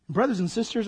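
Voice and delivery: usual speaking voice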